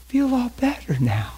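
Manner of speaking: put-on high, small child's voice; imitated soft-voiced boy. Boy looking up at pastor after prayer, imitated soft small voice. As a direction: pretend to be a relieved little boy. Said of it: "real soft voice".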